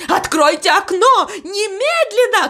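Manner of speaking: high, urgent cry; shouted demand. A narrator's reading. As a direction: demand to be let out, pitch jumping up. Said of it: "кричал он".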